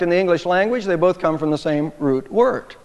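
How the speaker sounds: normal talking voice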